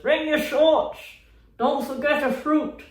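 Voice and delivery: shouting a cult invite into the forest; mock cult shout. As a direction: bellow it after someone walking away. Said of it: "I'd be shouting".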